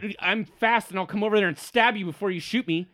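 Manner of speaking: high, loud, rushed threat; threatening halfling. Acted. Halfling threatening the man with the crossbow, heated bluster.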